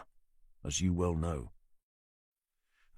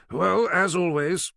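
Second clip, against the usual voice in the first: higher, slow, drawn-out dry doctor; the forensic doctor drawling his usual caveat; wry doctor voice; 11 semitones higher, 7 dB louder, slower than usual. Acted. Doctor character voice.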